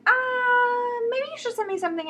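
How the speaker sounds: high, quick, polite brush-off; overly polite. Retold or imitated. Turning down an author whose book she hated, overly polite and high. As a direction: sweetly let the author down easy. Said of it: "I'll be very polite and I'll be like".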